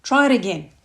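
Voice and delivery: insist, insulted; offended demand. Acted. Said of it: "Gary is all offended again".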